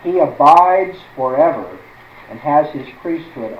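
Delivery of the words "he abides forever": loud and slow, leaning on every word; emphatic stress. Shown as a demonstration. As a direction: drive home He abides forever, slow and loud. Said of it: "But notice the emphasis".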